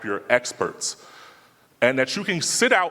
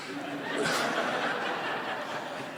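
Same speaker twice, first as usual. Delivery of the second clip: laughing at the memory; 10 dB quieter than usual. Real, not acted. Laughing at own story.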